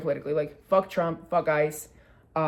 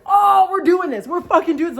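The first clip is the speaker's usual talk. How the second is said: hyped-up shout; 10 semitones higher, 9 dB louder than usual. Retold or imitated. Storyteller joining woman standing up to heckler, hyped-up shout. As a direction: square up and shout it with rising hype. Retelling squaring up for a fight.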